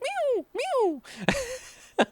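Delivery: tiny, very high kitten meow; Nori. Retold or imitated. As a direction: mew sweetly in a little squeak. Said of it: "has the sweetest little voice".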